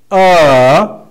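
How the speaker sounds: "Uh" dipping, then rising; fall and rise. Shown as a demonstration. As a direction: dip "Uh" and lift it loudly at the end. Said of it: "Repeat after me".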